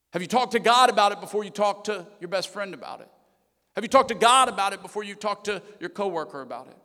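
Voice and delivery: preaching emphasis. Acted. Preaching, repeated question.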